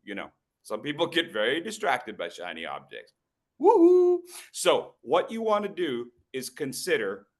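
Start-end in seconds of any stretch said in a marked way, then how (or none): 3.6-4.2 high, loud woo-hoo; whooping cheer. Real, not acted. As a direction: let out a big gleeful whoop. Celebrating his shiny head.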